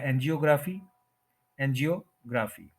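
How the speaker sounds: "एंजियोग्राफी" as an-jio-GRAA-fee; common mispronunciation. Shown as a demonstration. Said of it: "एंजियोग्राफी जो है वो गलत है".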